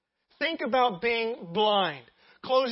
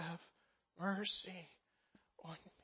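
broken plea; 2 semitones lower, 13 dB quieter than usual. Acted. Broken man seeking a Savior, hushed plea. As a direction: let each word drop softly, with pauses. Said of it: "And he cried out".

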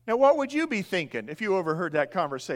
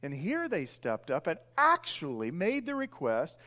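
ordinary talk

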